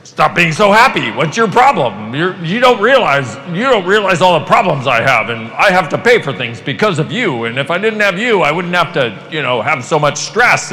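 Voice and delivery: put-on stressed parent snapping at a joyful kid; irritated parent. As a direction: scold the child for being too happy. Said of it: "They were like".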